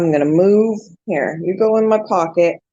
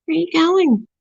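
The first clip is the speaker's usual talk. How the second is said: pet talk; 8 semitones higher than usual. Real, not acted. Owner asking her roaming pet where it's going, in baby talk. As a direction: call after the wandering pet, sweetly.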